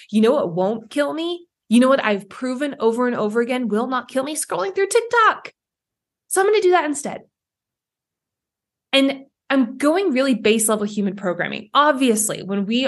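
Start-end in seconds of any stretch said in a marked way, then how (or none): 4.4-7.2 mock cheerful. Mock cheerful relief after fear.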